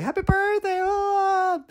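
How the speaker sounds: very high drawn-out cheer; mock birthday cheer. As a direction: imitate a squealing group cheer. Re-enacts group birthday cheer.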